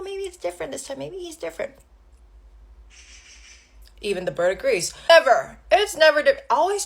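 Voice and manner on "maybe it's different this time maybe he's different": high, very soft voice; hopeful self-talk. Retold or imitated. Woman tells herself he is different, high soft hopefulness. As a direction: go small and high, coaxing yourself.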